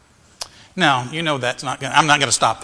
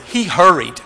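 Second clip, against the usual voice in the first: "hurried" pushed a bit louder; stress on hurried; 3 semitones higher, 4 dB louder than usual. Shown as a demonstration. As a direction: lean on the word "hurried". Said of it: "Emphasize the word".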